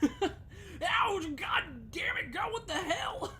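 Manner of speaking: high, loud laughing yell of pain; pained shout. Acted. Junpei hitting the floor, shouting in laughing pain. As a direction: yelp and curse through laughter. Junpei falls from bed, voiced.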